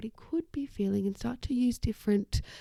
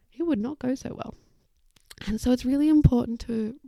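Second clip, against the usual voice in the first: normal talking voice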